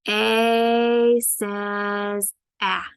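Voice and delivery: very slow, stretched letter sound; drawn-out, model A says ah for the class; slow modelled sound. Shown as a demonstration. Teacher to students, slowly stretching A says ah. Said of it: "I might say".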